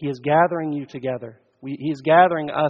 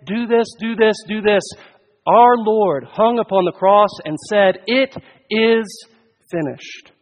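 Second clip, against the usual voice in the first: loud, raised preacher's proclamation; forceful preaching; 5 semitones higher, 7 dB louder than usual. Real, not acted. Preacher to congregation on Christ's finished work, forceful preaching. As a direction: proclaim it from the pulpit, louder.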